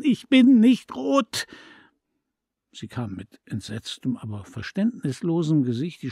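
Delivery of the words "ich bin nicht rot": high, loud shout through sobs; a child screaming it out in tears; furious child's cry, voice breaking; sobbing angry shout. A narrator's reading. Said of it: "schrie ich zwischen wütenden Schluchzern".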